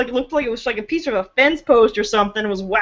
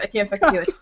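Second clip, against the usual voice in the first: ordinary talk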